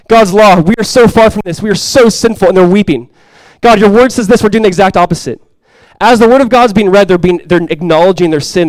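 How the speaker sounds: loud, high, impassioned sermon climax; preaching at full force. Acted. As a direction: preach it hard to the whole room.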